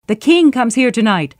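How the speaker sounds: a messenger calling out the news; announcing. Acted. Messenger announces the king's coming, proclaiming. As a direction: ring it out as an arriving herald. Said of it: "the messenger announced".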